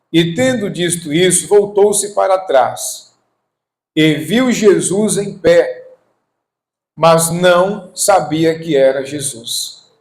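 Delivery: measured pulpit declamation; scripture reading. Acted. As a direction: read it out deliberately to the congregation. Reads scripture aloud to congregation.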